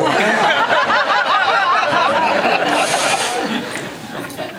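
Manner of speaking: high, hearty burst of laughter; big laugh. Real, not acted. Laughing at his own punchline.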